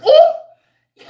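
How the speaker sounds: high, loud laughing ooh; delighted squeal. Real, not acted. Feeling good from viewers' praise, squealing ooh. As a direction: squeal with joy.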